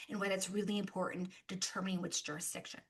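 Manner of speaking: rushed, whispered recap; whispered aside. Real, not acted. Presenter reminding the audience in a quick whispered aside. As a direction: toss it off under the breath.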